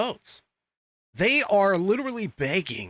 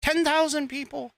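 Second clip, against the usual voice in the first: high, breathless mock gushing, much higher; mocking gushing fans; 13 semitones higher than usual. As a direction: parody an overexcited online fan, voice pitched way up. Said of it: "social media accounts were just like".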